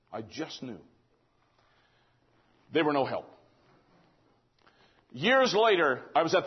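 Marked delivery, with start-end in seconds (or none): 0.1-0.8 very soft, low retold answer; understated repeat. Brother repeats his only answer to the questioner, understated. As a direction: let it drop right down, almost under the breath.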